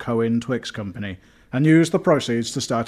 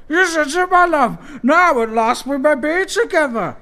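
loud, shrill falsetto outburst; shrill it out, very high and loud; 8 dB louder than usual. A narrator's reading. Penelope to Barry after the duel, shrilling. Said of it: "shrilled Penelope Nandos from the bar".

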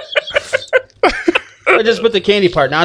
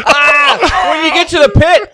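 pained yelp of disgust, higher; grossed out; 6 semitones higher, 3 dB louder, slower than usual. Real, not acted. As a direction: cry out at the awful taste. Reacting to very salty candy.

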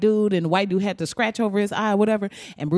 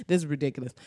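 usual speaking voice

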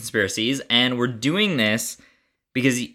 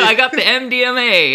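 laughing; 12 semitones higher, 7 dB louder than usual. Real, not acted. Joke, laughing.

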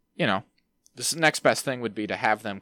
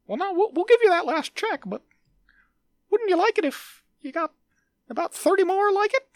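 loud, very high squeaky mouse; a greedy cartoon mouse, squeaking loudly; Mickey Mouse voice; 4 dB louder than usual. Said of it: "Mickey Mouse Voice".

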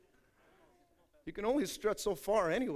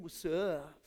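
cocky flirt; 5 semitones lower, 8 dB quieter than usual. Retold or imitated. Imitating a flirty visitor.

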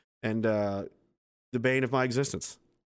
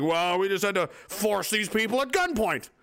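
mock official excuse; 7 semitones higher than usual. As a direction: sneer it like a self-satisfied official.

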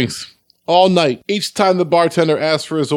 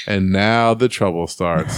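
low slow deadpan delivery; slow ominous punchline; 8 semitones lower, slower than usual. Acted. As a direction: lower and slow, drawn out.

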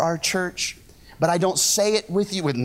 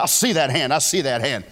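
usual speaking voice

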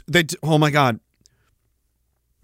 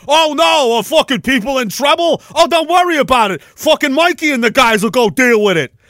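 loud, higher mocking jeer; macho firefighter taunt; 7 semitones higher, 5 dB louder than usual. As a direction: jeer at a fleeing man like a tough New York firefighter. Firefighter mocking a fleeing man.

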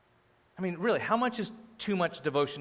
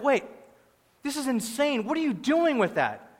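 higher-pitched, mock-alarmed disbelief; imitated flipping out; 9 semitones higher, 3 dB louder than usual. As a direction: act out onlookers freaking out. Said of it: "They were all flipping out".

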